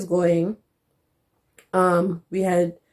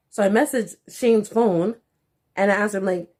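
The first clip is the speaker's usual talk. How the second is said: usual speaking voice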